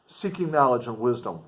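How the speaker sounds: normal talking voice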